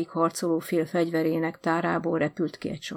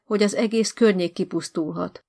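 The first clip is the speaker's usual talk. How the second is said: plain reading voice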